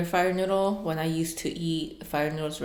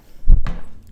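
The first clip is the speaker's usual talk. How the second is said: heavy sigh; 12 dB louder than usual. Real, not acted. Exhausted after too-spicy noodles.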